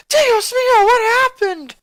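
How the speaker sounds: loud, high cry of alarm; a mother yelling on seeing the hurt boy; shocked yell. A narrator's reading. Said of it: "she yelled".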